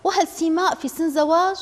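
put-on mother shocked her young daughter may marry, crying out; astonished cry. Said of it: "صاحت بدهشة".

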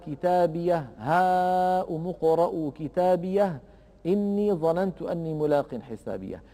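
measured chanted recitation of the verse; Quran recitation. Acted.

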